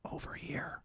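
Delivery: whispered lure, very soft and eerie; hushed movie-monster whisper; creepy mimicking whisper. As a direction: whisper it like a creature copying a voice. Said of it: "mimicking his voice, like, like in the movie".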